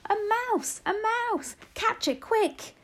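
high-pitched urgent shouts; shouting. A narrator's reading. Kitchen staff setting off after a mouse, shouting. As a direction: call the others to the chase, high. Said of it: "they were shouting".

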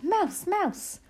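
high-pitched alarm cry; light mock shout, pitched right up. A narrator's reading. Witches spotting a mouse, mock shouting. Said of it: "There were no shouts of".

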